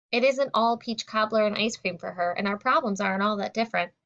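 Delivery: usual speaking voice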